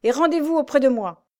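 lieutenant shouting an order; commanding order. A narrator's reading. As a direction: drive the men on. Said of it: "commanda le lieutenant".

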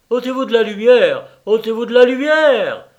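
loud, high, frantic yell; frantic cry. Acted. Rosenthal yells at the wrestler, loud and frantic. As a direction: yell in a panic. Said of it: "s'écria Rosenthal avec frénésie".

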